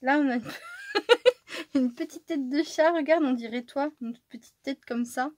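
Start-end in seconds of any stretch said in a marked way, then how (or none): 0.9-3.9 excited laugh. Real, not acted. Delighted at cat-head decoration.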